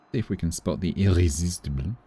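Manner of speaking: ordinary delivery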